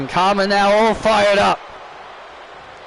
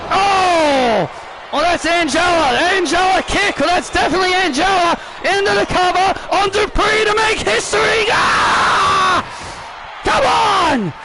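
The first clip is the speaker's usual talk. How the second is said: excited shouting commentary; 8 semitones higher than usual. Acted. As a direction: yell it like history is about to happen. Near-fall climax commentary.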